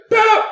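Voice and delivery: sharp high-pitched "Pap!"; high pap sound effect. Retold or imitated. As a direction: imitate a slap noise, high. Imitated pat sound, very high.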